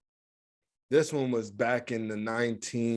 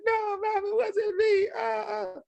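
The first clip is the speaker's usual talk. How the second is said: put-on crying child. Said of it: "He's like".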